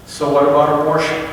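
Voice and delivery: ordinary talk, nothing marked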